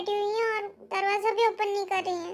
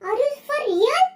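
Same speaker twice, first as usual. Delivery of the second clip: angry shouting; 6 semitones higher than usual. Acted. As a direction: blast her with outrage. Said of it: "इतना चिल्लाने की आवाज".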